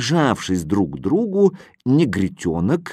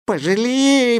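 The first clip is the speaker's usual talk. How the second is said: imploring; 12 semitones higher, slower than usual. A narrator's reading. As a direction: plead for mercy. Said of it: "взмолился маленький Чукча".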